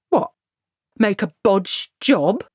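high, indignant dwarf, slow on the insult; appalled disbelief. A narrator's reading. Dwarf answering Granny's demand for a repair, appalled. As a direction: question it as if affronted.

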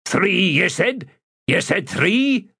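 put-on a frightened innkeeper in a South Islander accent. A narrator's reading. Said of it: "the accent South Islander".

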